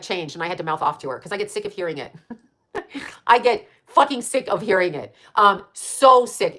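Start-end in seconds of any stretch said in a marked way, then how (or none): 2.7-3.2 soft giggle. Real, not acted. Laugh after rant. 5.4-6.6 slow, loud, drawn-out so sick; drawn-out emphasis. Real, not acted. Emphatic exasperation.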